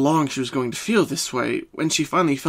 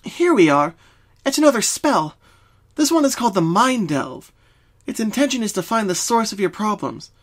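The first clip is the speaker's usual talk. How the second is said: raised, lightly voiced confident unicorn; confident Twilight; 7 semitones higher than usual. A narrator's reading. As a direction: reassure her with calm certainty. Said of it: "Twilight said confidently".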